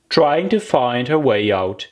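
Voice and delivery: usual speaking voice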